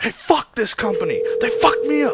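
loud, high pretend rant at the company; mock angry outburst. Prank caller coaches Neon to curse the company, mock shouting. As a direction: yell like a fed-up worker. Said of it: "Just pretend to be like".

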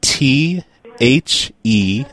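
slow, letter by letter; dictating. Real, not acted. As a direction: spell it out clearly, one letter at a time. Reads out the password letters.

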